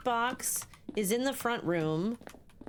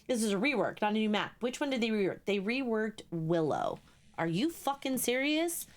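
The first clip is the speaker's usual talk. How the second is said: ordinary talk, nothing marked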